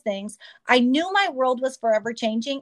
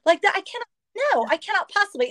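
retold desperate plea; 6 semitones higher than usual.

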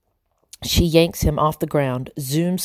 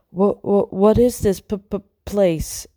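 high, stammering frightened mouse; shaky voice; 5 semitones higher, slower than usual. A narrator's reading. Frightened mouse asking about a strange backyard, shaky and stammering. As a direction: stutter nervously on the first sounds, pitched up. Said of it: "her voice shaky".

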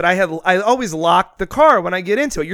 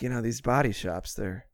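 low, much softer lazy brother voice; mock laid-back brother; 6 semitones lower, 11 dB quieter than usual. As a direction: shrug it off after a sigh. Brother imitated continuing.

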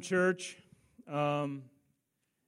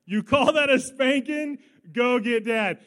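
louder retold taunt; 5 semitones higher, 9 dB louder than usual. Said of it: "And then the line comes".

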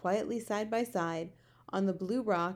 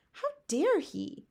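high, slow indignation; outraged cry; 9 semitones higher, 3 dB louder, slower than usual. A narrator's reading. Sophie is offended for the Queen, outraged. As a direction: draw out the outrage, pitched up. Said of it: "Sophie cried".

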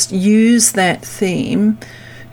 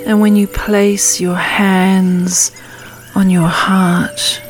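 calm grounding exercise. Acted. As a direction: guide them gently into stillness. Leads a meditation.

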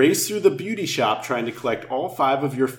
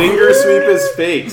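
excited; 6 dB louder than usual. Real, not acted. Game reveal.

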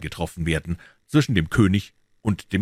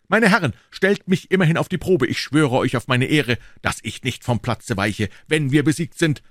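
high, impassioned pledge; calling out; 7 semitones higher, 4 dB louder than usual. A narrator's reading. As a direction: plead your case to hesitant comrades, voice raised. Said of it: "begriff ihre Unentschlossenheit und rief".

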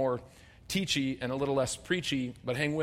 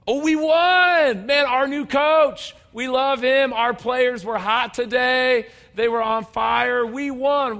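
much higher and louder, slow, crowing over the win; gloating fan; 8 semitones higher, 9 dB louder, slower than usual. Pretend. Preacher playing a fan celebrating the team's win, excited and loud. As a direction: shout it like a thrilled fan boasting.

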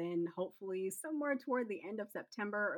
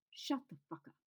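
snapped retort; 8 semitones higher, 4 dB quieter than usual. Acted. Angry retort, pitched high.

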